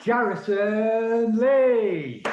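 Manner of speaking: loud, high, stretched-out name; big MC call-out, held long; announcer-style introduction. Real, not acted. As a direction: draw out the act's name like a ring announcer. Host announces act's name.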